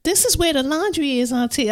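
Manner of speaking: put-on high little kid voice; chirpy young niece giving tour; child voice.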